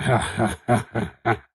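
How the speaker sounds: low, put-on character chuckle; a deliberate stagey laugh to oneself; laughing to himself. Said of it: "Nuggets is laughing to himself".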